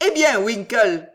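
loud hail across the ice; called out. A narrator's reading. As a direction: call out to a friend. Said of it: "cria monsieur Pickwick".

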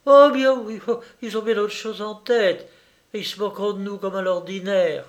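put-on high, quick girlish retort; loud outburst easing into quick grumbling; dismissive young lady. A narrator's reading. Girl dismisses calling the boys.

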